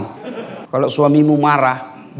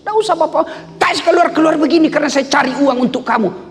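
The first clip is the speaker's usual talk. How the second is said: very high, heated mock retort; quarrelling husband; 13 semitones higher than usual. Husband defends working for money, quarrelling. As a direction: fire back indignantly, pitch way up. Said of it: "Oh, bertengkar".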